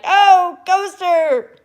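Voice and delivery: high, loud imitation holler; mock excited yell. Teasing a viewer just talked about, mock excited yell. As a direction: imitate an excited friend yelling out. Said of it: "being like".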